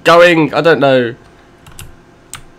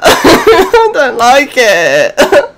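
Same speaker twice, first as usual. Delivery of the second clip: slow, high, laughing through the fear; nervous laugh; 8 semitones higher, much slower than usual. Real, not acted. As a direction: laugh off the scare shakily. Nervous laugh after scare.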